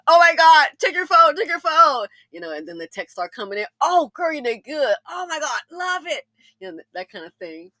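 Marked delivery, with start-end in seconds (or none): 0.1-2.1 high, loud thrilled shout; excited squeal. Retold or imitated. Excitedly calls her girlfriends. 3.8-6.2 high, gushing mock squeal; gushing girlfriends. Girlfriends texting back excitedly.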